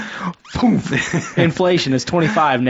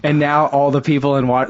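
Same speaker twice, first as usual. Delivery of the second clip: usual speaking voice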